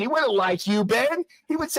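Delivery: furious sneering taunt; angry taunt. Real, not acted. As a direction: jab at him by name. Heated argument over religion.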